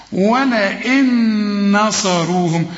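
slow ولئن نصروهم, the nun merged with a long hum; nasal merging. Shown as a demonstration. Teacher models idgham, slow nasal recitation. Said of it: "النون دي بعدها نون".

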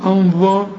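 أنظى with a thick ظ sound; heavy dhal mistake. Shown as a demonstration. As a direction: imitate the error, أنظى dark and heavy. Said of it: "دي بقى ذال ولا ظا". (أنظى بظاء مفخمة غلط)

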